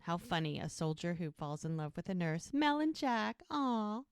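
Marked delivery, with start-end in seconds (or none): 2.5-4.0 high-pitched cooing over a sweet couple. Real, not acted. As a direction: gush adoringly, voice way up high. Host gushes over the couple.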